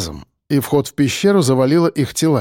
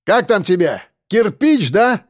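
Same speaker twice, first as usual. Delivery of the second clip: raised, provoking question; calling out; 7 semitones higher than usual. Acted. Defender needling the gang leader by name, calling out. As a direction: needle him from a distance.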